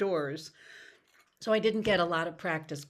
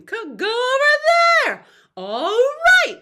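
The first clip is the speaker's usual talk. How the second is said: high, loud calls of go over there and All right; calling out; 14 dB louder than usual. Shown as a demonstration. Teacher demonstrating playful calls. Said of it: "continue that breath holding".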